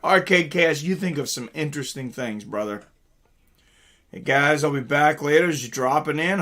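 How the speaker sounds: ordinary delivery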